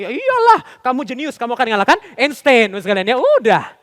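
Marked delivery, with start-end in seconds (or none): 0.0-2.7 boastful modern father. Acted. Today's father praising his genius child, loud exaggerated boasting. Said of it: "Eh, bapak zaman sekarang".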